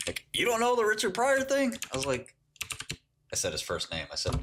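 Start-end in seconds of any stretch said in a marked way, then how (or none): 0.3-1.7 high-pitched, disbelieving friend; mock incredulous. Friend shocked he missed the Richard Pryor reference, mock incredulous. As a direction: imitate a scandalized friend, voice shooting up.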